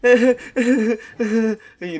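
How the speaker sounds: high, loud nervous laughter; laughing while unsure; uneasy laugh demo. Shown as a demonstration. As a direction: show the uncomfortable laugh. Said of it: "we're all laughing like that".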